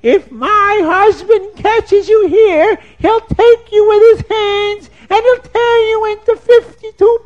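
high, loud, slow scolding woman's voice; shrill woodcutter's wife. Acted. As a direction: shoo the stranger off in a high, loud voice. Woman voice in told story.

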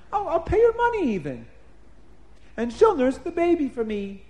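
high, loud gushing delight; delighted princess. Acted. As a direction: gush with relief and thanks. Princess thrilled at the offer.

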